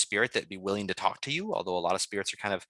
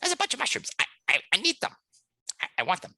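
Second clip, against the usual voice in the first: high-pitched, eager mouse stammer; manic little mouse; 12 semitones higher, faster than usual. Acted. Mouse begs the player for mushrooms, manic. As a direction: plead greedily in a tiny squeaky voice. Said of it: "he's kind of motioning over there".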